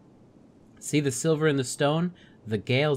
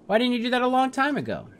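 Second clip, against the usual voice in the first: much higher, fast demanding question; exasperated outburst; 13 semitones higher, 4 dB louder, faster than usual. Pretend. Player voicing Rook, demanding why Juno waited, exasperated. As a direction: fire it off in disbelief.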